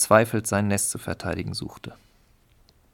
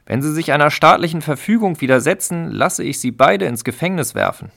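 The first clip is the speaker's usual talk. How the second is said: higher, much louder warning; threatening; 7 semitones higher, 7 dB louder than usual. Acted. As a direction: threaten them hard. Official continues threat.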